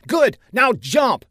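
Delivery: high shouted command; urgent shout. A narrator's reading. Saffinger orders the woman off the stage edge, shouting.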